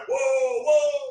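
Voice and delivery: loud rising whoa at a reggae set; hyped crowd cheer; hype shout. Retold or imitated. Hyping up his cousin's reggae set, loud whoa. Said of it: "just like".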